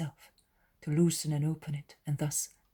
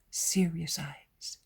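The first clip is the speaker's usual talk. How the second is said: ordinary delivery, nothing marked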